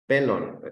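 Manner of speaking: "Pelón" dicho PÉlon, primera sílaba alta; acento en PE. Shown as a demonstration. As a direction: carga la E de "Pelón" y deja caer LON. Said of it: "se lo pongo en la E". ("Pelón" con el golpe de voz en PE)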